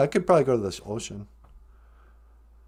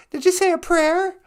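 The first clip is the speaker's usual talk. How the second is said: grandma character voice; 13 semitones higher than usual. Pretend. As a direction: chirp it like a sweet old granny. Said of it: "Nana Bonjour".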